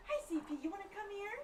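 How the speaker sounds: very high, soft doggy voice. Real, not acted. Owner greeting her dog coming in, cooing baby talk.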